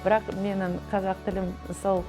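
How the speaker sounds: normal talking voice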